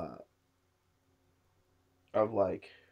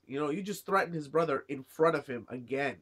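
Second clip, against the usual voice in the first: ordinary delivery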